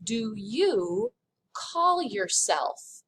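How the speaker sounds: "How do you call yourself?" drawn out very slowly; slow literal gloss. Shown as a demonstration. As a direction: spell out the literal meaning word by word. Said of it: "it literally means".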